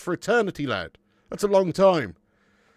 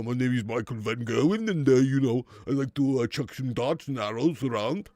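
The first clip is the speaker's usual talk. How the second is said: a hint of a deeper Dutch giant; lightly voiced Dutch darts player, lower; deeper mock Dutch accent; 4 semitones lower than usual. Streamer mimicking a Dutch darts star for chat, lightly deeper. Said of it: "He's more like, kind of like maybe a bit of Andre the Giant".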